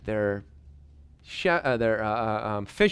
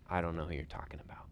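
hurried whisper; 7 semitones lower, 13 dB quieter, much faster than usual. Acted. Peter's denial acted out.

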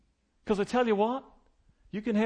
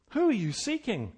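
ordinary talk

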